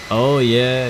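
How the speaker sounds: usual speaking voice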